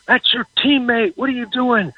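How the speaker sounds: high-pitched mock yell, no real volume; imitated yelling. Sportswriter quotes a lineman riding his teammate, mock yelling. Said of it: "Mason kept yelling".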